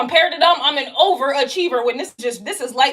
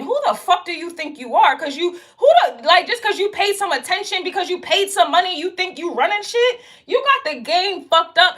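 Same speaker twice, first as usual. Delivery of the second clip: indignant rant; 6 semitones higher than usual. Real, not acted. Scolding entitled clients, indignant and pitched up. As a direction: demand who they think they are.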